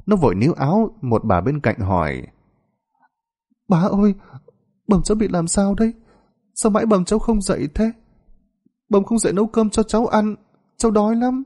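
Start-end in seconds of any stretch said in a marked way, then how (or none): 3.7-11.4 put-on very high, tearful child voice; whimpering little boy. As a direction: plead like a frightened small child. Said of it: "Nó chỉ mếu máo gọi". (giọng bé trai mếu máo, cao vút)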